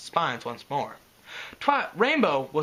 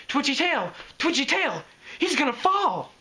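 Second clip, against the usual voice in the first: higher, urgent squeal of warning; excited alarm; 12 semitones higher, 3 dB louder than usual. Acted. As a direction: sound the alarm, bouncing with worry. Pinkie warns of fall.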